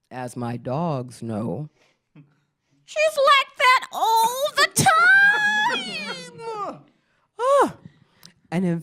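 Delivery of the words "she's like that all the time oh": high, loud crying laugh; a real high squealing cackle; shrieking laugh. Real, not acted. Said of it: "cry-laugh".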